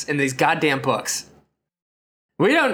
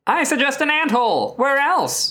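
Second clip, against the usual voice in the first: higher, chirpy alien sidekick; Martian sidekick voice; 9 semitones higher than usual. Acted. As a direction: pipe up as the little Martian. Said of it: "Flipto answers".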